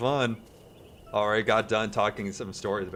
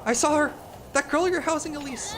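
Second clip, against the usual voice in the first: much higher, needling squeak; sly nosy neighbour. Acted. Neighbour needling Elise, high sly squeak. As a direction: turn the accusation back slyly. Voicing Miffy.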